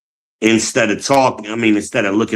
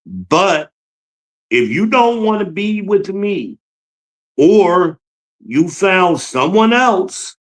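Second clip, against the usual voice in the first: a lightly pained, higher plea; retelling his own hurt plea to her, higher; pleading retold reply; 9 semitones higher, slower than usual. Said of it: "my response to that was like".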